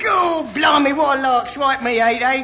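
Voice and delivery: put-on Cockney accent. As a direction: shout it like a Cockney soldier in the trench. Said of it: "the colourful Cockney humour".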